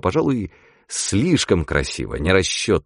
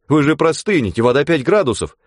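surprised warning; 7 semitones higher than usual. Acted. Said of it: "удивились очкарики".

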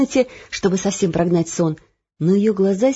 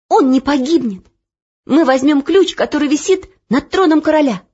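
louder and higher, resolute; determined girl; 7 semitones higher, 6 dB louder than usual. Acted. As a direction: promise boldly to save Gurt. Olya determined. (решительно)

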